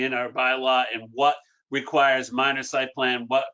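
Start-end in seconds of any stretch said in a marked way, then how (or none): none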